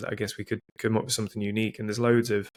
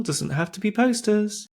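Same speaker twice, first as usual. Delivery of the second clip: pitched way up, emphatic and playful; high emphatic; 10 semitones higher, 3 dB louder than usual. Real, not acted. Host insisting to co-hosts, high playful emphasis. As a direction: push the point up high, teasing.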